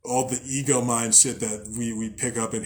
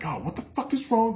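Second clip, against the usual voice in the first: mock alarm; 10 semitones higher than usual. Imagined alarmed reaction to yelling.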